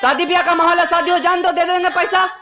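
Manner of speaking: high-pitched heated protest; angry quarrelling. Acted. Son tells uncle to wait till wedding is over, heated protest. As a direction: argue back hotly, pitch held high.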